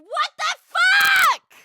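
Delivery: loud, very high, slow shocked yell; shocked shout. Real, not acted. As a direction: scream it out in shock. Shocked by alert.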